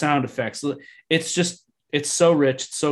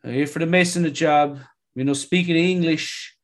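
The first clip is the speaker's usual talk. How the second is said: hammed-up Italian immigrant English; put-on Italian accent in broken English; 3 semitones higher, 3 dB louder than usual. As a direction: imitate an Italian accent, a bit louder on the greeting. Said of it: "He is speaking in this Italian accent".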